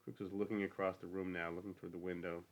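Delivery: ordinary delivery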